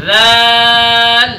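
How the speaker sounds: very slow, held رےل letter name; drawn-out letter name. Shown as a demonstration. Teacher demonstrating each letter slowly. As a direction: stretch ریل out clearly for the class. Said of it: "ایسے ہی چاروں حروفوں کے اندر".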